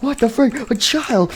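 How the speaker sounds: very high, squeaky disbelief; shocked outburst. Acted. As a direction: squeal it in horrified surprise. Nightmare Bonnie shocked by child.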